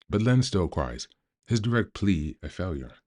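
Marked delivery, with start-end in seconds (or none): none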